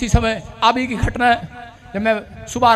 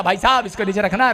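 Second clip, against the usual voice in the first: polite, loving request; 5 dB quieter than usual. Shown as a demonstration. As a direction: ask mildly and courteously, with no force. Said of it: "अगर प्यार से बोलता". (प्यार से, धीमी आवाज़ में)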